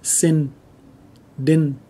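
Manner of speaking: Sin, din, each closing on n instead of ng; Southern dialect ending. Shown as a demonstration. Teacher showing how the South says it, n ending. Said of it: "it'll sound more like an N".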